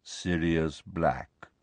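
very slow, low naming; mournful. A narrator's reading. Nick names Harry's lost godfather, mournful. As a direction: draw the name out low. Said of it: "looked mournfully at Harry".

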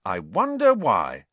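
loud, pointed sarcasm; sarcastic. A narrator's reading. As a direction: say it with heavy irony. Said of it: "said Ron sarcastically".

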